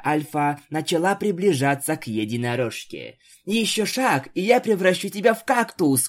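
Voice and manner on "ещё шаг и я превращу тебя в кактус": higher, sharp magical threat; a unicorn's raised threat; threatening. A narrator's reading. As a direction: warn her off, voice raised high. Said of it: "угрожала Твайлайт".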